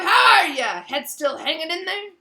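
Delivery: hearty, booming greeting; roaring. A narrator's reading. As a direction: roar it jovially. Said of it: "he roared".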